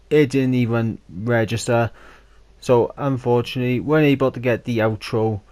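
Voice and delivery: loud, upbeat outro read; YouTube outro. Acted. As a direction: sign off to viewers, projected. Outro to camera.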